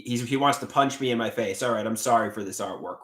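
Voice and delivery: ordinary talk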